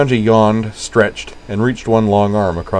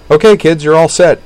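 bright upbeat officer voice; cheerful customs officer; 9 semitones higher, 9 dB louder than usual. A narrator's reading. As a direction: send them off cheerfully. Customs agent voice, loud.

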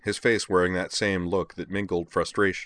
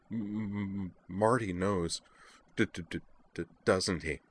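softer, sobbing stutter; miserable stammer; 2 semitones higher, 5 dB quieter than usual. A narrator's reading. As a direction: barely get the question out. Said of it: "Kate replied miserably".

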